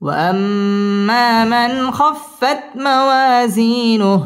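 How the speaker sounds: very slow chant leaning on موازينه. Shown as a demonstration. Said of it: "wow ঠোঁট গোল করে উচ্চারণ করতে হবে". (নিচু সুরে তিলাওয়াত)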